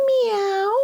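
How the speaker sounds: high little mewing meow; cat meow. A narrator's reading. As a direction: break the silence with a cat's meow. Pet's meow ends the silence.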